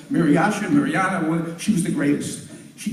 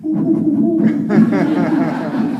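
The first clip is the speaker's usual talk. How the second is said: chimp hoots; 2 semitones higher, 4 dB louder, much slower than usual. Acted. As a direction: hoot like a guilty chimp. Chimp hooting.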